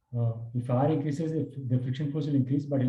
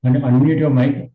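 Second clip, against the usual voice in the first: much louder, calling across the class; 11 dB louder than usual. Real, not acted. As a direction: call it out loud to the student. Loud repeated call to student.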